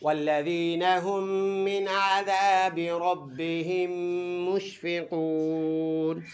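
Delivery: slow melodic tajweed recitation; teacher ends the lesson with recitation; Quran recitation. Shown as a demonstration. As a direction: chant it slowly to the close. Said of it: "فل آیت".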